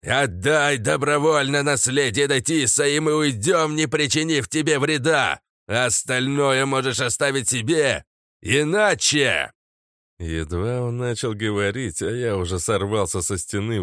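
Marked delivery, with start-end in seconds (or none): 0.0-9.5 high, loud formal ultimatum; commanding old mage. Acted. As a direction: proclaim the demand from afar. Old mage challenges the lich.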